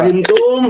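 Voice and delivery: "Fahimtum?" pitched high and rising; questioning intonation. Acted. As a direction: check the class with a rising "Fahimtum?". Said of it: "ustaz nanya".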